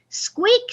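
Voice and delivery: very high tiny squeak; mouse squeak. Acted. Reader playing the mouse, very high squeak. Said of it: "said the mouse".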